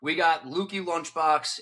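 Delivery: ordinary talk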